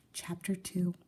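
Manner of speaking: ordinary delivery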